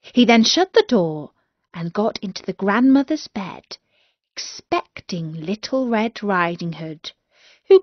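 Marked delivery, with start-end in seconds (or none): none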